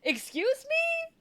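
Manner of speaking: high, slow, incredulous "excuse me"; mock outrage. Reacting to a scammer starting her own scheme, incredulous.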